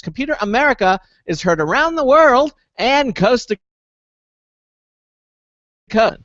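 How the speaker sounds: audio dropping out mid-sentence; broken, choppy broadcast line; sound cutting out. Real, not acted. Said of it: "the sound keeps cutting out".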